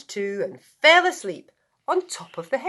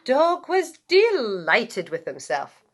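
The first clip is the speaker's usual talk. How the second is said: plain reading voice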